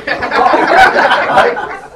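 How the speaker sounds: loud burst of laughter through the words; laughing out loud. Real, not acted. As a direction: crack up mid-sentence. Laughing at his pasty story.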